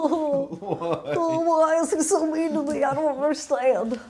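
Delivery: hammed-up whimpering complaint; crying [name] voice. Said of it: "just me doing a hilarious, like, crying person voice".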